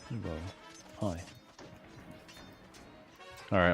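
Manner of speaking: ordinary talk, nothing marked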